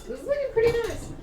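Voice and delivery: pleased squeal. Real, not acted.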